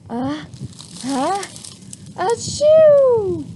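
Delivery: rising uh, uh, then a loud high achoo; mock sneeze. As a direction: wind up and explode into the sneeze. Wildcat demonstrates sneeze.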